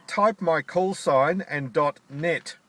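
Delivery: normal talking voice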